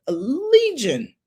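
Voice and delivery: legion drawn out slow and high; stressed legion. Shown as a demonstration. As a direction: dwell on legion slowly for weight. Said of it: "a legion of demons".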